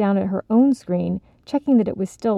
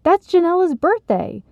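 high, bright, louder; excited announcement; 10 semitones higher, 4 dB louder than usual. Acted. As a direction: announce it with delight. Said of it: "Sylvie announced".

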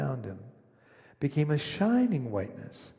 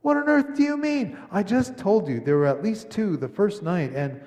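much higher and louder, indignant disbelief; astonished; 6 semitones higher, 5 dB louder than usual. A narrator's reading. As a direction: cry out in amazement like a boy. Boy protests in disbelief.

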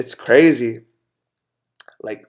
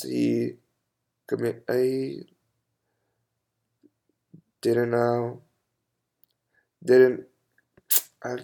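quiet, halting words through tears; fighting back tears mid-sentence; choked up; 7 dB quieter, slower than usual. Real, not acted. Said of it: "I'm choked up".

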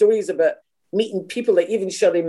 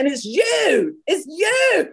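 high, drawn-out cry on YOU; climbing, astonished exclamation; amazed recognition; 12 semitones higher, much slower than usual. Retold or imitated. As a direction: imitate someone thrilled to realise who it is.

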